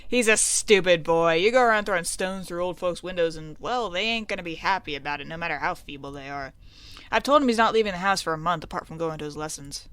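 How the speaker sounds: parent laying down the law; stern parent. Acted. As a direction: scold the boy in character. Voices stern parent about Dickie.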